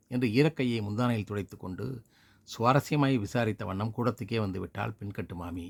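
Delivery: ordinary delivery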